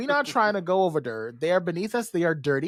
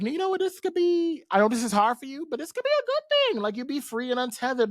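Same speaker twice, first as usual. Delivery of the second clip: much higher, coaxing voice; sweet-talking empress; 8 semitones higher, faster than usual. Retold or imitated. Empress coaxing Charles to let go, high sugary voice. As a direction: coax him, high and sweet. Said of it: "Empress is just like".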